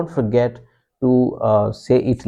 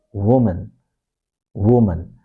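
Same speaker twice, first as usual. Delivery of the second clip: "woman" as WUU-mun; first-syllable stress; 2 semitones lower than usual. Shown as a demonstration. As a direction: punch the first syllable of "woman", schwa in the second. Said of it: "stress is on the first syllable".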